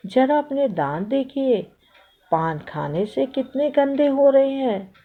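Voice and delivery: high-pitched nagging reproach; chiding. A narrator's reading. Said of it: "टोका". (टोकने वाला ऊँचा स्वर)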